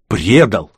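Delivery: «Предал» said alone with force; bitter exclamation. A narrator's reading. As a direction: punch out «Предал» as an outcry. Bitter echo of the betrayal.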